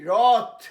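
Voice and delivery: রথ, open o, said loud; রথ repeated, loud and clear; wrath said roth. Shown as a demonstration. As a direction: hammer out রথ loudly with an open o. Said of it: "আবার বলি".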